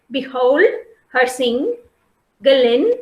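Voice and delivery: "behold / her sing / Galen", a pause after each foot; divided into feet. Shown as a demonstration. Teacher showing the feet, "behold, her sing, Galen" chunked with pauses. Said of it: "they have divided as feet".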